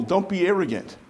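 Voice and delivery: usual speaking voice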